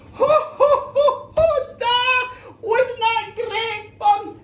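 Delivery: high, loud crowing delight; gleeful boy. Acted. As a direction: burst out triumphant with whooping ho-hos.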